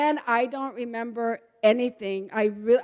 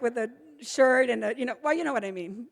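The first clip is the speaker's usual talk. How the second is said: ordinary delivery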